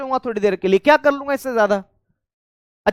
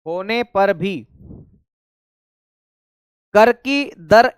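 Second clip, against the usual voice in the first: dictation; 2 semitones lower, slower than usual. Shown as a demonstration. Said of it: "इसमें लिखेंगे मेरे साथियों".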